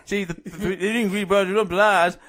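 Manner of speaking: slow, garbled Geordie attempt; failed Geordie accent. Contestant arguing for Bad Boys, badly faked Geordie accent. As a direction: stumble through a mangled Newcastle accent. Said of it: "that's not Geordie".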